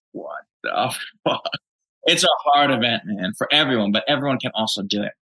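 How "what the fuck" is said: stunned disbelief. Retold or imitated. Friend overwhelmed at the race.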